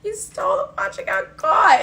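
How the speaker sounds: high, loud triumphant accusation; gleeful gotcha. Real, not acted. The narrator calling out her friend's theft, loud and gleeful. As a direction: crow it like you caught her.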